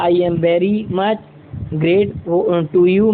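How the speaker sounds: very slow, every word drawn out; slow teaching demo; slow model phrase. Shown as a demonstration. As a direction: spell it out slowly for learners. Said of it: "क्या बोल दिया".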